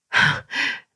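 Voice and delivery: short, voiceless scoffing laugh; a quick contemptuous huff of a laugh; scornful laugh. Acted. Daughter-in-law scoffs at mother-in-law.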